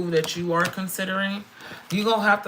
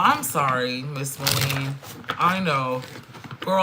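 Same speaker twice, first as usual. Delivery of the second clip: ordinary talk, nothing marked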